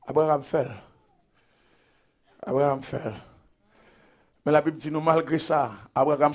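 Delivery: very soft, low pulpit voice; hushed storytelling. Real, not acted. Preacher telling church what Abraham did for Lot, hushed and low. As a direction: drop right down and confide it.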